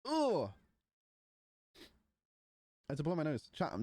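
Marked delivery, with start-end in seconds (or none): none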